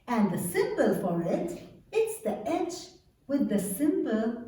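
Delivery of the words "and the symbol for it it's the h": ordinary talk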